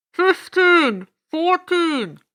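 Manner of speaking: loud countdown over a PA; booming loudspeaker. Acted. Loudspeaker counting down to the broadcast, booming announcer voice. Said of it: "A loudspeaker voice boomed".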